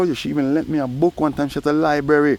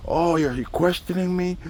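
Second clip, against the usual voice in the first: high, offended imitation of her; indignant little squeal of disbelief; mock indignant; 7 semitones higher than usual. Voicing Sophie snapping back at him, mock indignant. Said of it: "she started yelling at me".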